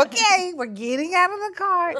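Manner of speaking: put-on high, sweet obedient kid voice; suddenly all good behaviour; meek little boy. Son giving in when dad steps in, high meek voice. Son obeys once dad's mentioned.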